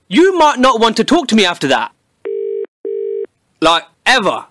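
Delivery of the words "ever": normal talking voice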